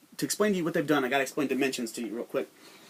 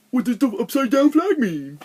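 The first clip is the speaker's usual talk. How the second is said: much higher, louder mocking whine; dumb viewer voice; 10 semitones higher, 8 dB louder than usual. Mocking viewers who ask what the flag means, high and loud. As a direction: mimic a clueless viewer asking. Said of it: "that's what you guys sound like".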